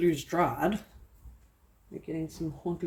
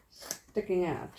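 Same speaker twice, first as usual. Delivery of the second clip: usual speaking voice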